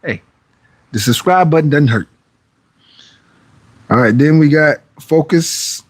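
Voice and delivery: ordinary delivery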